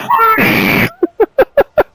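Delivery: high, loud yelping laughter; shrieking laugh. Real, not acted. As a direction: burst out laughing mid-shout. Laugh after the yelled call.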